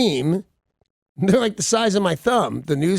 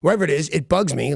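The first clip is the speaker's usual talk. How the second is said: normal talking voice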